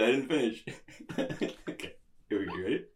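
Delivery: usual speaking voice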